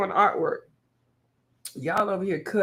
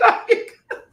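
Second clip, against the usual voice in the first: laughing quote; 6 dB louder than usual. Retold or imitated. Laughing while quoting Dawn.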